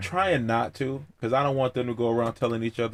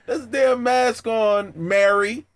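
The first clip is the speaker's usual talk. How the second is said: mock scolding; 10 semitones higher than usual. Scolding anti-maskers, high and loud.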